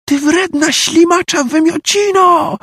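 very high, slow, screamed insult; hateful shriek. Acted. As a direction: shriek it with pure hatred. W głosie Gryzeli zadźwięczała nienawiść. (nienawistny wrzask)

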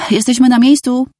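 high-pitched but restrained call; mild shout. A narrator's reading. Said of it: "krzyknął ktoś z przodu autobusu". (lekki okrzyk wyższym głosem)

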